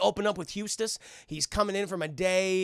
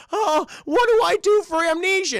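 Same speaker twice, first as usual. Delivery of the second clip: high, loud whimpering plea; tearful panic; 11 semitones higher, 7 dB louder than usual. Acted. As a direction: beg the computer, close to tears. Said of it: "He's like".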